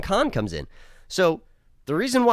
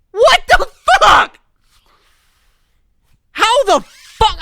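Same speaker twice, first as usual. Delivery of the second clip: much higher, louder, stretched disbelief; outraged yell; 9 dB louder, slower than usual. Real, not acted. Vlogger yelling at the show's ending in real outrage. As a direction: bellow it like you can't believe it.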